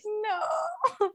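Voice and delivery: high, crying "No"; mock whiny cry. Friend mocking the rejected one with a crying no. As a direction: whimper the no like a hurt kid.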